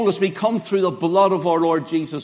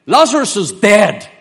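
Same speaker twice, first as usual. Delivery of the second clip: louder, forthright Lazarus is dead; firm declaration; 4 semitones higher, 9 dB louder than usual. Retold or imitated. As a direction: proclaim it plainly and firmly. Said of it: "That's the way he says it".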